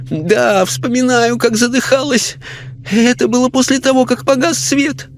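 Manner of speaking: high, anxious lady's voice; lady spirit recalling. A narrator's reading. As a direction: recount her last night, upset.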